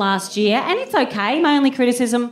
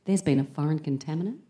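low, much softer secretive hush; hushed confidential; 7 semitones lower, 9 dB quieter than usual. Retold or imitated. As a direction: lean in and tell a dark secret. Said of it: "she looks over both shoulders and she leans in".

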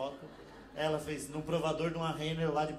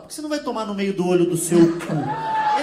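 loud, laughing curse; woman cursing at the commenter, loud and laughing; Brazilian comeback; 3 semitones higher, 11 dB louder than usual. Retold or imitated. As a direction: blast the insult while laughing. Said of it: "já vinha embaixo e falava".

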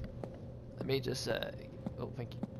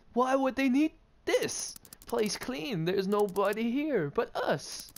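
higher voice; 8 semitones higher, slower than usual. Pretend. Voicing player character.

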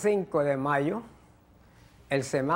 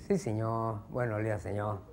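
low, soft, meek mock-Chinese accent; 6 semitones lower, 4 dB quieter than usual. Chinese traveller greets the immigration officer, meek mock Chinese accent. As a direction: deferential newcomer in a put-on Chinese accent. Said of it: "El chinito".

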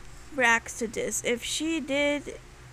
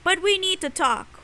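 louder, higher, insistent; emphatic serious voice; 7 semitones higher, 7 dB louder than usual. Shown as a demonstration. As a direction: announce it firmly. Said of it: "this is my serious voice".

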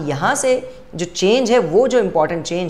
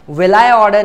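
wrong version stressed; 3 semitones higher, 8 dB louder, much slower than usual. Shown as a demonstration. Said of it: "नहीं होना चाहिए".

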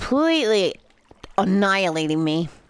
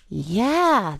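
ordinary talk, nothing marked

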